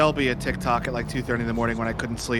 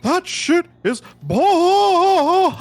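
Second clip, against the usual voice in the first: much higher, loud, very slow drawl; Hank Hill voice; 8 dB louder, much slower than usual. Retold or imitated. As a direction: drag it out like a Texan dad. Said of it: "In a Hank Hill voice".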